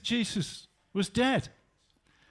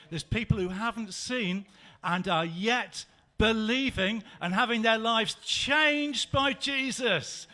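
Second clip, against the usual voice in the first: normal talking voice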